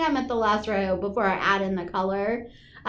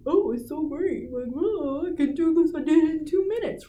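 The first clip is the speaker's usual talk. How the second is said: a hint of a breezy, smug husband; Tom voice; 4 semitones higher than usual. Mocking her husband for the co-hosts, lightly smug. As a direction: lightly mimic the husband bragging how easy it was. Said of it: "that's my Tom voice".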